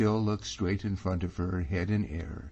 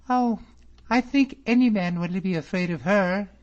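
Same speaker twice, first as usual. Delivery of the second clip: put-on high, louder exclaiming girl; crying out; 13 semitones higher, 7 dB louder than usual. A narrator's reading. Miss Drummond exclaiming to David about her cousin, high and loud. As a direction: call it out lightly. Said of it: "she cried".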